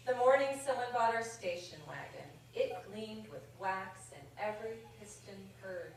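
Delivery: gentle, measured verse reading; poem reading. Acted. Reads a more serious love poem.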